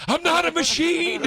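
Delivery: high, loud crying protest; hammed-up wail, caught weeping; mock tearful outburst. Drunk friend caught crying at a concert, mock tearful wail. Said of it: "You're crying?".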